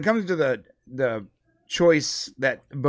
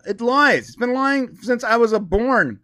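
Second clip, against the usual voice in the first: insistent; 8 semitones higher, 4 dB louder, slower than usual. Retold or imitated. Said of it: "And I'm like".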